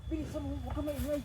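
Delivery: hushed urging. Acted. Schemer hurrying an accomplice along quietly, hushed. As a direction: keep it down while sneaking.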